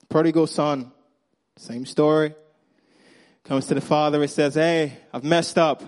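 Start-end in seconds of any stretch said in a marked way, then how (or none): none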